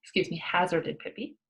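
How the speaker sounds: plain reading voice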